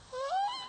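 very high, thin squeal; startled boy's squeak. Retold or imitated. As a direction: squeak out a feeble high noise as the son. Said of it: "my son goes".

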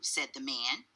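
plain reading voice

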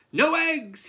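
high, loud outraged yell; bellow. A narrator's reading. Farmer finding empty nests, loud high bellow. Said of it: "he bellowed".

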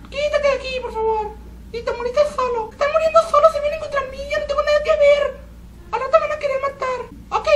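strange squeaky high-pitched voice, whiny and teary; odd high squeak while gaming; weird high voice. Real, not acted. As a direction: talk in a strained high whine. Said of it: "¿Por qué ando hablando así?".

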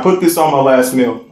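ordinary talk, nothing marked

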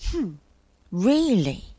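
pondering, doubtful question; hum, then muse on it; thoughtful. A narrator's reading. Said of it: "rubbing his chin thoughtfully".

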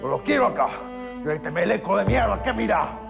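gritos agudos de rabia contra los guardias; furia desatada. Acted. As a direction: estalla de furia y no se contiene. Preso furioso estalla contra todos. (grita furioso contra los gauchos)

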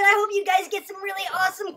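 ordinary delivery

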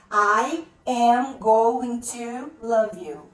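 slow, separated words for learners; slow modelling. Shown as a demonstration. English teacher dictating an example sentence, slow and deliberate. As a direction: say each word slowly and firmly.

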